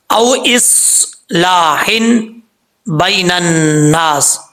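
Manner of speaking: drawn-out tajweed reading; slow measured Quranic chant; slow Quran recitation. Shown as a demonstration. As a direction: recite each word slowly and carefully. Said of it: "मुश्किल लफ्ज़ था".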